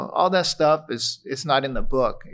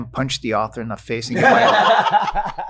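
louder and louder through laughter; laughing mock threat; 2 semitones higher, 7 dB louder than usual. Widower retelling his joke to his wife, laughing loud. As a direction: rant it while cracking up. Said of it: "the first thing I told Michelle was like".

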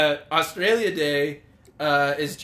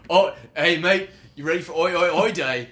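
louder, hammed-up Australian "hey, mate"; hammed-up Australian accent; mock Australian accent; 3 semitones higher, 5 dB louder than usual. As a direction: play a jokey Aussie bloke. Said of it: "mock Aussie greeting".

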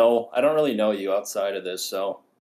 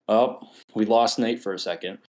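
ordinary talk

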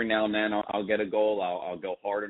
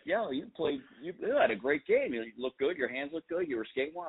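higher-pitched mock teammate gushing; imitating buddy's praise; 7 semitones higher than usual. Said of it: "he's like".

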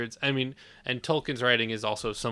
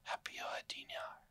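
whispered Elvish; 17 dB quieter than usual. Pretend. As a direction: breathe the Elvish like a seductive elf. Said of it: "what was that voice".

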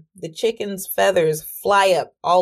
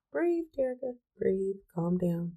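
much softer self-soothing murmur; calming herself; 11 dB quieter than usual. Retold or imitated. Telling herself to calm down, much softer murmur. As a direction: talk yourself down, softly. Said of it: "I had to breathe".

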